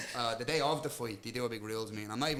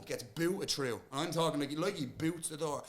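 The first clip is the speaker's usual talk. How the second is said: ordinary talk, nothing marked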